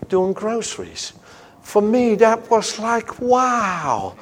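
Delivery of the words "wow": ordinary talk, nothing marked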